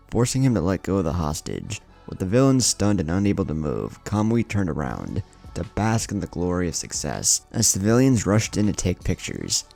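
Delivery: usual speaking voice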